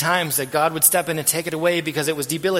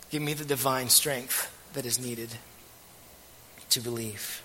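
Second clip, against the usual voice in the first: paraphrased prayer; 4 dB quieter than usual. Acted. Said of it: "what could have been said here is".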